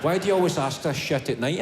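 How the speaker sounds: normal talking voice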